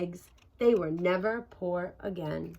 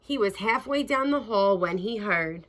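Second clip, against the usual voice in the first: ordinary delivery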